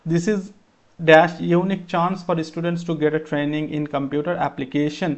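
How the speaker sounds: measured, deliberate reading pace; slow reading aloud. Shown as a demonstration. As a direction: slowly read out the exam sentence. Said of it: "এখানে আর দেখো".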